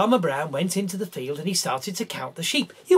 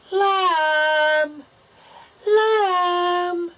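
high, loud drawn-out call; 11 semitones higher, 5 dB louder than usual. Acted. Farmer calling for his lost lamb, loud and long. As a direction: call across the field for the lamb. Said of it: "he started to shout out".

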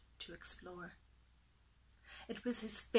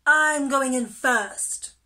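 loud, slow, higher-pitched boast; bold turtle; 4 semitones higher, 13 dB louder, slower than usual. A narrator's reading. Turtle claiming first place, loud and bold. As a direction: claim it loudly, drawing out the words.

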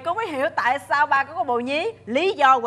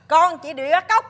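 forceful announcement; 5 semitones higher, 6 dB louder than usual. Acted. Kidnapper announces the abduction.

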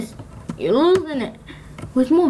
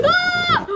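startled scream. Real, not acted. Wolves attack during countdown.

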